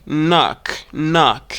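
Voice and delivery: American "knock" with open "nahk" vowel; American pronunciation. Shown as a demonstration. Teacher contrasting for learners, American "knock". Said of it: "This word in American English".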